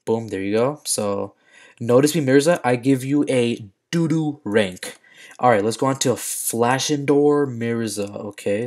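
ordinary delivery

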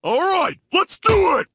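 high, rousing shout; rally the friends with a surge of resolve; fired-up resolve. Acted. Decides to go after criminal.

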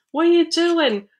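urgent storybook call; worried yell. A narrator's reading. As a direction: shout down to check on someone. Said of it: "I yelled".

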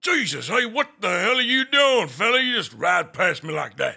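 put-on Western cowboy accent, gruff and teasing. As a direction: play the old-West cowboy mocking a name. Holds a cowboy voice all along.

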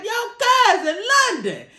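high, loud, laughing excitement over the game; giddy shrieking football mom; pumped up mom. Retold or imitated. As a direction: squeal like a proud mom watching her son score. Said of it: "she would call me so pumped".

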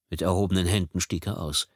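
ordinary delivery

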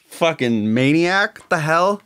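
ordinary delivery

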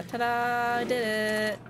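ordinary talk, nothing marked

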